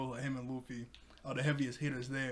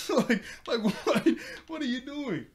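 laughing disbelief; 10 semitones higher, 7 dB louder than usual. Real, not acted. Laughing at Zoro's comeback.

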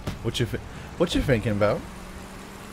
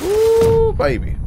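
delighted squeal; 9 dB louder, much slower than usual. Real, not acted. Gamer squealing as he lands a big hit.

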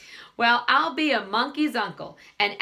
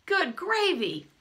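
surprised exclaim; 9 semitones higher, slower than usual. A narrator's reading. Charlie tastes the pie.

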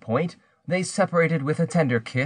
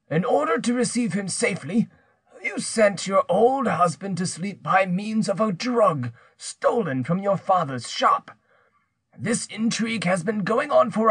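higher-pitched, menacing accuser's voice; accusing villager; 7 semitones higher than usual. Acted. Pierre confronts a guilty woman about her lover, accusing. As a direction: confront her with what you know.

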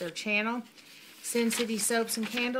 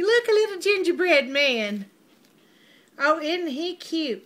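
delighted; 6 semitones higher, 7 dB louder than usual. Real, not acted. Finding the gingerbread man gift.

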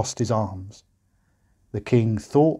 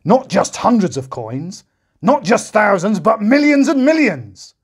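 higher and louder, slow, climbing to a peak; roaring king; 9 semitones higher, 7 dB louder than usual. A narrator's reading. The king listing the huge debt, roaring ever higher. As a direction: build the roar word by word. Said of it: "roared the king".

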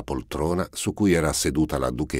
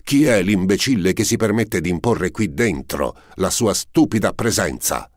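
loud, higher furious shout; furious yell; 6 semitones higher, 5 dB louder than usual. A narrator's reading. Prince yells at whoever knocks, furious shout. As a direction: yell it at the door, beside yourself. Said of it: "urlò fuori di sé".

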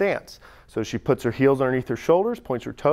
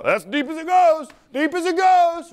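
put-on protesting older lifter; 14 semitones higher, 4 dB louder than usual. Coach mimics fifty-year-old lifters who can't reach parallel, high strained protest. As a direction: imitate an older guy insisting, pitched way up. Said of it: "fifty-year-old guys love to go".

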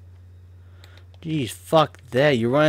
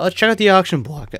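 raised, louder, voice cracking mid-line; 7 semitones higher, 6 dB louder than usual. Real, not acted. Streamer moving on to the auction block, voice cracking. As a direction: crack upward on the words. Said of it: "that was a rough voice crack".

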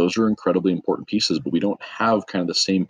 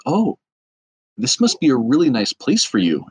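higher-pitched, patronizing imitation; talking to a kid; 6 semitones higher than usual. Lady to contractor at the bar, as if talking to a kid. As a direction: imitate her talking down to a small child. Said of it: "like she's talking to a kid".

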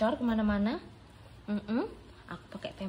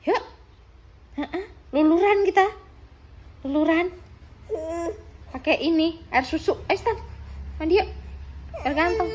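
high excited coaxing; 9 semitones higher, 6 dB louder than usual. Real, not acted.

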